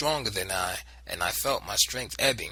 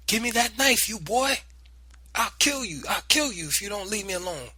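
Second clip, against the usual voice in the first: furious shout; 11 semitones higher than usual. Acted. Aunt and boy struggling over knife, frantic shouting. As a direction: yell threats in the fight.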